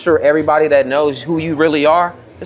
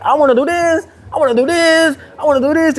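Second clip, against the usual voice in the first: high, eager childish mimic of a friend; mocking high voice; 12 semitones higher than usual. Mimicking his friend's scattered old self, high and eager. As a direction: squeak it out like an overexcited kid. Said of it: "You was like".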